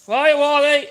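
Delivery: loud, high outdoor shout; calling the dog. Real, not acted. Owner calls a dog gone walkabout, loud high call. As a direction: holler the dog's name.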